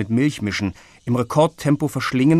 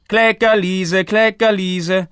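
put-on much higher, louder, slow singsong child; crowing little boy; 9 semitones higher, 6 dB louder, slower than usual. A narrator's reading. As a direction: crow the nickname like a teasing toddler. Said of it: "krähte es knapp neben Lissys rechtem Ohr". (Kleinkind kräht neckend im Singsang)

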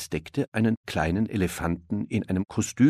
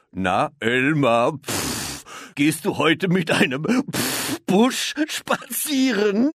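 slow, higher, snorting teasing voice; grinning hippo; 9 semitones higher, 4 dB louder, much slower than usual. A narrator's reading. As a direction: tease with hippo snorts, slowly. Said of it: "grinste eines der Nilpferde".

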